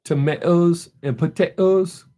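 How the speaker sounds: slow attempt at a British accent; imitated British accent. Echoing the daughter's British accent, imitated British accent. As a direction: copy a British accent, drawn out. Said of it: "Kinda like that".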